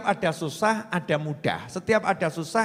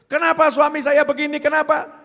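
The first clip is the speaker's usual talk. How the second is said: higher, louder exasperated complaint; complaining wife; 8 semitones higher, 9 dB louder than usual. Retold or imitated. Wife lamenting her husband's behaviour, loud and exasperated. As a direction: ask why, fed up and loud. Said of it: "Sama juga istri begitu".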